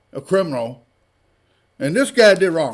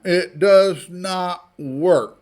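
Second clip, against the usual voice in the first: each word laid down slowly and firmly; slow emphatic; much slower than usual. Real, not acted. Ranting to viewers about gun laws, slow deliberate emphasis. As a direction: spell it out word by word.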